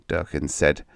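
plain reading voice

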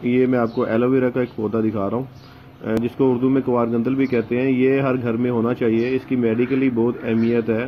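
usual speaking voice

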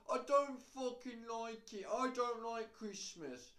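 high, loud, drawn-out mock tantrum; whingeing moan. Moaning to Mum about Christmas, hammed-up whine. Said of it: "you'd be moaning about how you don't like Christmas".

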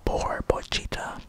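ordinary talk, nothing marked

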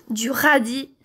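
ordinary talk

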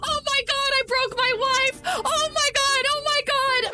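high-pitched panicked cries; frantic wailing. Pretend. Player who broke the wife, frantic high wail. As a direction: wail it over and over in hammed-up panic.

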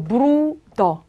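브루더 slowly, ending in an open "uh"; vocalised ER ending. Shown as a demonstration. Teacher models the ending for students, slow vocalised ER. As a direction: draw out 브루더, no R at the end. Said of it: "그럴 땐 요걸 한꺼번에 어로 발음해요".

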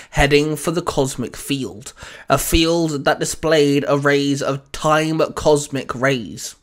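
ordinary delivery

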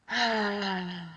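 long audible sigh out; exhaling sigh. Shown as a demonstration. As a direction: breathe out with a sigh. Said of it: "Exhale with a sigh".

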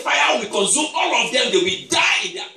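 loud, high prophetic warning; threatening decree. Retold or imitated. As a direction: proclaim it as doom on the witches.

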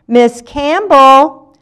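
high, loud, drawn-out call to the teacher; blurted out. A narrator's reading. Olive calling her teacher to tell on Bertie, blurted out. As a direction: pipe up urgently as a tattling pupil. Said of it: "she blurted out".

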